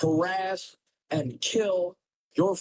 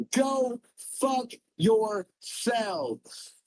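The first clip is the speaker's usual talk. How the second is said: dragged-out curse; 6 semitones higher, much slower than usual. Real, not acted. Cursing chat, drawn out.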